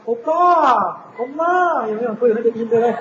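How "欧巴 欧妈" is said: high, swooping mock Korean; Korean accent. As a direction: exaggerate the Korean rising lilt. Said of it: "后面那个四那个音".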